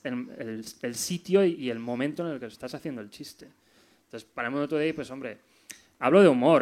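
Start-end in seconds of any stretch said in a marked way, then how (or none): 6.0-6.6 loud, punchy insistence; emphatic. Real, not acted. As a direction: hit it hard to make the point. Insists on humour, not insults.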